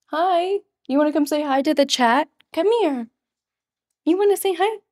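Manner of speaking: high sweet voice to a pet; pet talk. Real, not acted. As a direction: coo at the dog to come. Talking to her pet Kiki.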